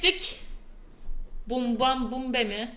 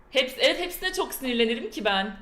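ordinary talk, nothing marked

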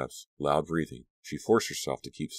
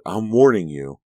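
loud, higher-pitched threat; shouted warning; 4 semitones higher, 8 dB louder than usual. A narrator's reading. Dee threatening her bandmate Danielle, shouting. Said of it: "Dee shouted".

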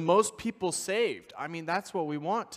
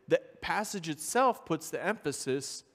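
usual speaking voice

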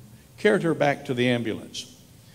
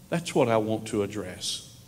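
ordinary talk, nothing marked

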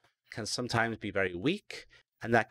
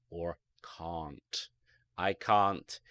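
ordinary delivery

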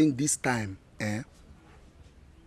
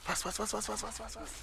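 hushed urging; 6 semitones higher, 17 dB quieter than usual. Acted. Hurrying the goat quietly.

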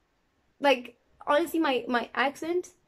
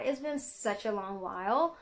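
ordinary delivery

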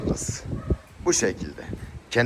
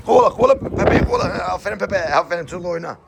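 urging the dog; 8 semitones higher, faster than usual. Real, not acted. Owner cheering Pepe to chase the puppy, high and eager. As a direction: egg the dog on, pitched high.

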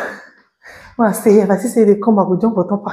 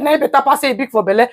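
ordinary delivery

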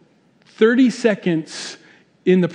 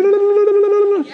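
loud high babbling to drown someone out; high la-la-la babble; 13 semitones higher, 3 dB louder than usual. Acted. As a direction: babble on one high note with ears plugged. Plugging ears to drown out coworker.

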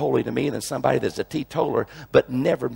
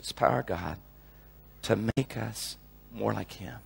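hushed preaching; 5 semitones lower, 8 dB quieter than usual. Acted. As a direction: drop to a near hush and linger. Hushed closing appeal.

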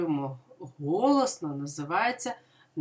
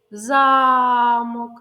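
Замок with the А loud and held; ЗАмок with a loud first syllable; stress on first syllable; 4 semitones higher, 8 dB louder than usual. Shown as a demonstration. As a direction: lean on the first vowel of Замок. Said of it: "Звук А".

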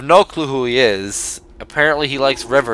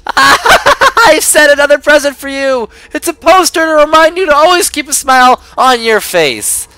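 high, loud, beaming wake-up call; chirpy cartoon host; 13 semitones higher, 7 dB louder than usual. Greeting a prisoner awake as game host, high and beaming. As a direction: sell it like an over-eager cheerful mascot. Cheery host gives a present.

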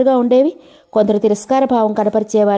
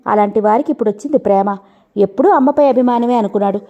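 ordinary delivery, nothing marked

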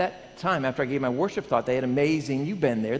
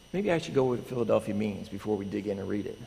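ordinary talk

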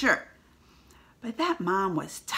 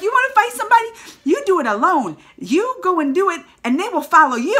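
higher, louder tough mama voice; fierce mom; 8 semitones higher, 6 dB louder than usual. Retold or imitated. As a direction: lay down the law like a proud mother. Voicing the mom's bold line.

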